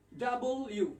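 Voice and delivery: "ডাবল ইউ" in two distinct parts; correct letter name. Shown as a demonstration. Teacher writing it out for learners, correct letter name. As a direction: sound out "ডাবল", then "ইউ". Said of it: "এটার মূল উচ্চারণ হবে".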